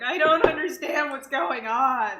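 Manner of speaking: confused self. Retold or imitated. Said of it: "and I'm just like".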